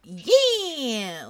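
pumped-up loud yeah; excited cheer. Real, not acted. Hyping up the next call.

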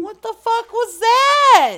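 high, loud, drawn-out outcry; startled shout. Real, not acted. Friend reacting to the prank, startled shout. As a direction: shout it in high-pitched disbelief.

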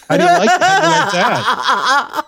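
high laughing gloat; laughing taunt. Real, not acted.

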